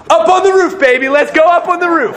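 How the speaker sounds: rally the brothers to the roof; excited. Retold or imitated. Friends urging each other up onto the roof, excited call.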